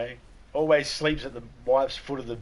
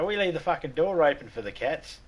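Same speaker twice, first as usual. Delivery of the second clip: normal talking voice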